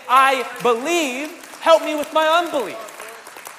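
loud, slow, ringing proclamation; bold declaration. Acted. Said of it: "we stand on the promises ... and we say".

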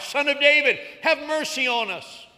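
two blind men calling out to a passing crowd; crying out. Acted. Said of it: "begin to cry out".

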